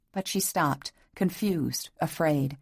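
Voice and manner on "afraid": ordinary delivery, nothing marked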